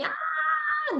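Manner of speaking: high, mild mock scream of pain; imitated child's scream. Mother imitates her paralyzed son, high mock scream. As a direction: recreate the son's cry, not full voice. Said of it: "be screaming".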